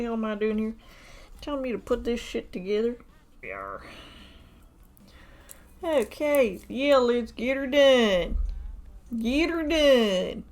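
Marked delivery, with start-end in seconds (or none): 3.4-3.8 low mock pirate "Yarr"; a hammed-up pirate grunt; pirate growl. 5.8-10.4 slow drawn-out country drawl on "get her done"; a hammed-up rural southern drawl. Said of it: "my accent is being attacked here".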